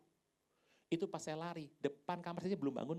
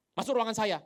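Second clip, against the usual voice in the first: loud, raised command; bossy summons; 6 semitones higher, 13 dB louder than usual. Acted. Boss calls employee into the office, commanding. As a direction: order them in like an angry boss. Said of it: "kita kalau dipanggil bos".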